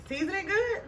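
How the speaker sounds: high, pointed reminder; emphatic high insistence. Acted. Insisting on seasoning well.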